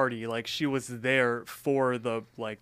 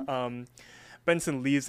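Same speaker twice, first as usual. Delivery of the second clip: usual speaking voice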